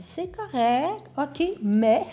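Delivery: high, drawn-out "correct" before a catch; teasing imitation of hedged praise; coach's reserved approval. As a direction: mimic a coach about to criticise. Mimics coach's hesitant approval.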